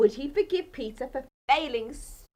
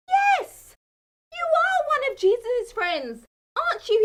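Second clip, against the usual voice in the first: lightly voiced accuser, pitched higher; accusing bystander; 12 semitones higher, 4 dB louder than usual. A narrator's reading. A stranger accusing Peter, lightly voiced higher accuser. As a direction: point the finger, a touch higher.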